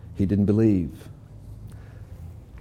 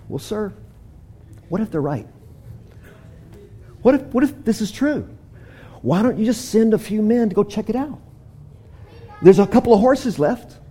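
timid servant; 6 semitones higher than usual. Acted.

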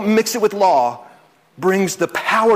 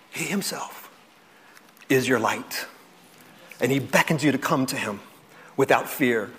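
soft and lower; quiet, lower, intimate; hushed; 5 semitones lower, 5 dB quieter than usual. Real, not acted. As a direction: draw them in with a near-hush. Intimate close of the point.

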